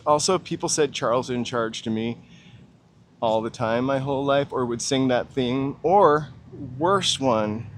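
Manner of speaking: ordinary talk, nothing marked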